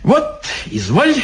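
put-on lively, eager old-timer; perky old man. A narrator's reading. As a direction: pipe up briskly as a little old man. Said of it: "бодренько заговорил старичок".

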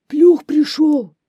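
very loud, high wolf cry; wolf repeating the alarm. A narrator's reading. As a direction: howl the alarm out slowly. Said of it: "повторяет волк". (волк кричит высоко и протяжно)

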